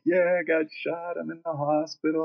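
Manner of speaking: mock weak, pitiful voice; feeble injured friend. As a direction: imitate a woozy patient phoning in. Shot friend on the phone.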